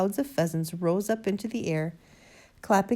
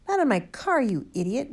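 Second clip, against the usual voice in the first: high, loud outburst, falling away; angry bellow; 6 semitones higher, 4 dB louder than usual. A narrator's reading. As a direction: bellow at the fool in outrage. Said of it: "Mister Hazel bellowed".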